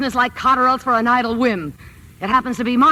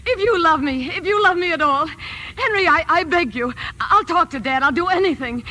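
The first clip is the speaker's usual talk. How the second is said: high, begging plea that slows at the end; cling to him with every word; desperate pleading; 7 semitones higher than usual. Acted. Wife begs her husband not to walk out, higher and pleading.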